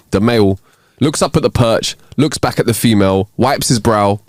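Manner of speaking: usual speaking voice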